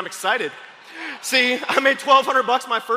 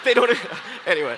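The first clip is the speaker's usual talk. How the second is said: ordinary talk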